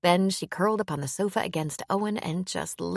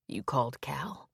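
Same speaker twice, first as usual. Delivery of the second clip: gentle, much softer question; softly; 3 semitones lower, 6 dB quieter than usual. A narrator's reading. Owen asking Hannah about the lawyer, soft and gentle. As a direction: ask it softly, careful not to upset her. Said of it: "he asked softly".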